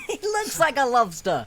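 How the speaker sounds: high, talking through laughter; laughing. Real, not acted. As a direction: giggle the line out. Laughing over the lobster joke.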